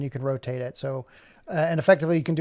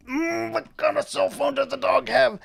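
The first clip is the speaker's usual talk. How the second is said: high-pitched comic musing; silly high voice; 11 semitones higher than usual. Pretend. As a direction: ponder it in a high put-on cartoon voice. Puts on voice while searching.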